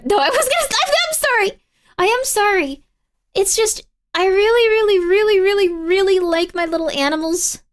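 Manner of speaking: high, loud, flustered apology; flustered high pleading. Real, not acted. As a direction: squeak it out, flustered.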